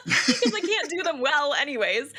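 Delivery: real self-mocking laugh in the voice. Real, not acted. Laughing at her own bad accents.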